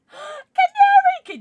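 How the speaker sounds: gasp, then a loud high squeal; excited squeal. Real, not acted. Streamer spotting a viewer's gift, gasping excited squeal. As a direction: stretch the name out in a high cry.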